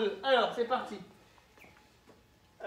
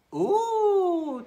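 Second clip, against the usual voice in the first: surprised; 8 semitones higher than usual. Acted. Teacher hears puppet whisper.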